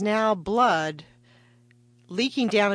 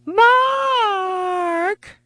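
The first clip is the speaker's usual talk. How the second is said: high, loud cry for her husband; calling out; 10 dB louder than usual. Retold or imitated. Bleeding wife calling her husband Mark from upstairs, crying out. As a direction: yell the name down the stairs in alarm. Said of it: "I called out to my husband".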